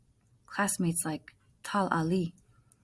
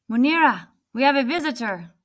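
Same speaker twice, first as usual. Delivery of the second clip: louder, higher call into the house; 6 semitones higher, 8 dB louder than usual. Acted. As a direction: call your wife to the door. Calling wife from outside.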